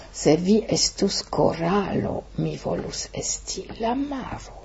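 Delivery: slow, soft recitation, fading to almost nothing; poem recital in Esperanto. Acted. Reciting the Esperanto version of the poem, hushed and slow. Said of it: "j'ai dit au micro son poème".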